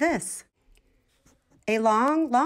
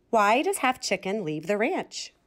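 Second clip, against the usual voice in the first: ordinary delivery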